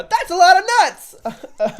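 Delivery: high, loud, slow quoted movie character; loud quoted movie line. Retold or imitated. Friend quoting a favourite comedy to his cohost, hammed-up high shout.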